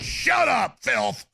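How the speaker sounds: high, sneering mock bark; mock bullying commies. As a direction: snarl at them like petty tyrants. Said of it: "They're just like".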